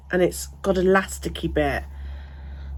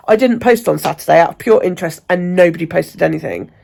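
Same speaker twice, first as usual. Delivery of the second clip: ordinary delivery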